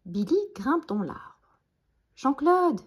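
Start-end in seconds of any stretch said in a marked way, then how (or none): 2.2-2.8 high, loud call. A narrator's reading. Billy calls from up the tree, calling out. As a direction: shout down to a friend. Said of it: "crie-t-il".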